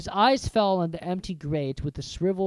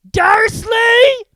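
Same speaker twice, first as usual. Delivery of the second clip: huge high bellow; boomed; 17 dB louder than usual. Acted. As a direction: shout the name across the hut. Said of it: "he boomed".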